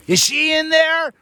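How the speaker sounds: high imitated yell from outside; mock yell. Woman in truck yelling at the van, high mock yell. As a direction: pitch way up, calling out. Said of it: "I hear somebody yell from the outside".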